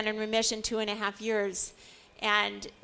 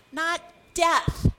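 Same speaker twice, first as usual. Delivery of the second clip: usual speaking voice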